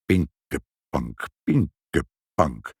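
very slow, low mutter; low, drawn-out old man's murmur; muttering Sinterklaas. A narrator's reading. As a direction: mull the name syllable by syllable. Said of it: "prevelde Sinterklaas".

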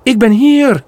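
a distant lure calling him. Acted. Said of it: "hoort hij".

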